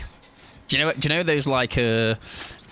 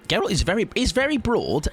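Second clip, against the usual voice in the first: Geralt is very broad, said much higher with lift on very; excited admiration; 8 semitones higher than usual. Acted. Streamer gushing about Geralt's broad build, pitched high.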